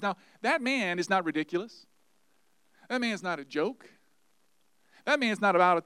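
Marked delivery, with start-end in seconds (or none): none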